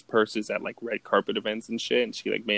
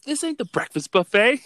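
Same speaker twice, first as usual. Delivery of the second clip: high-pitched, louder comic challenge; mock confrontational shout; 12 semitones higher, 6 dB louder than usual. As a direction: re-enact a brawler's indignant punchline. Retold line that starts a fight.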